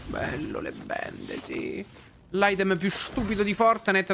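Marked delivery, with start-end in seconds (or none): 0.1-1.9 whispered deadpan sarcasm; sarcastic whisper. Real, not acted. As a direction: mutter it under your breath. Sarcastic whisper about bandages. (sussurro sarcastico)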